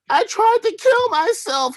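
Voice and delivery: very high, put-on dramatic whine; whiny roommate voice. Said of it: "he said".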